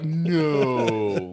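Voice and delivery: loud, long mock cry of protest; drawn-out dismay. Crew protests the syringe punishment, dismayed. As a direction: stretch it out in hammed-up horror.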